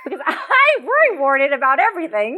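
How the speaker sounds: high, laughing confession; giggling at oneself while talking. Real, not acted. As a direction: say it through a burst of laughter. Laughing at own childhood nickname.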